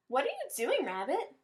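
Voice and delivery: high call on the first words, like a small mouse calling across the meadow. Acted. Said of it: "Mouse called".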